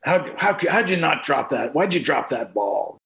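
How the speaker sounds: grandfather grumbling at the ball game, imitated; muttered cursing. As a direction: curse under your breath, not loudly. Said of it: "he'd be cursing under his br-, not loudly".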